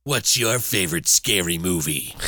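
low, menacing phone killer; Ghostface. Acted. Killer drops the friendly act on the phone, menacing. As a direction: drop suddenly into a horror villain's low voice. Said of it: "his voice is very iconic".

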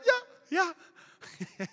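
high, whimpering eager yelps; eager little dog. Retold or imitated. Husband hoping for leftover dessert, high puppyish eagerness. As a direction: pipe up like a puppy begging. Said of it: "I felt like a little dog".

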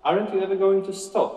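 high, puzzled supervisor voice; lightly voiced supervisor, surprised; supervisor asking. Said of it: "asked the supervisor".

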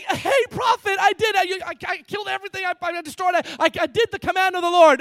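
high, loud, hammed-up eagerness; cheerful boasting. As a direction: blurt your achievements brightly at the prophet, high and loud. Replays Saul's cheerful boasting to Samuel.

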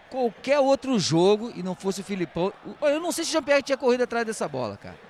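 usual speaking voice

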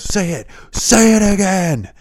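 high, loud order; mock demand. Boss demanding the developer say it again, loudly. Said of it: "and he goes".

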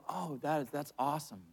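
soft, absent-minded reply, barely there; distracted mumble. Husband half-listening to his girlfriend, soft distracted mumble. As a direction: mumble it like you're not listening. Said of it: "I'm kind of in the moment".

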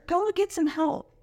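ordinary delivery

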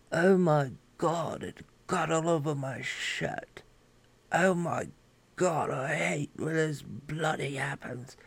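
put-on a prim, well-spoken royal fussing over his shirt in a posh British accent; proper King Charles impression. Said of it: "he's more proper speaking than that".

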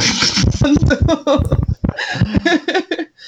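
high, drawn-out giggling laugh; real laughter. Real, not acted. Joking about a handsome actor with friends, laughing. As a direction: burst into a long, high laugh.

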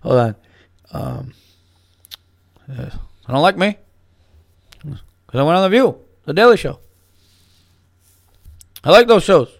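mock presidential whine, rising toward the end; comic Obama voice building up; Obama impression. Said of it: "He goes".

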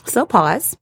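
ordinary talk

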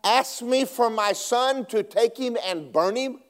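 normal talking voice

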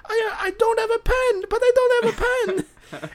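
put-on very high squeaky old lady; helpless granny squeak, no pen. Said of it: "She's like".

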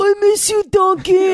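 high, loud East Coast ad voice; overacted ad read, East Coast; commercial voice. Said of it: "I did a Dunkin' Donuts commercial".

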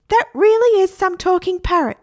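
high, loud squawky parrot. Acted. Parrot repeating talk to Mum, high parrot voice. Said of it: "said the parrot".

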